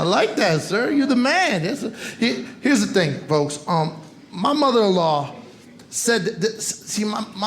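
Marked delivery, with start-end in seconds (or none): none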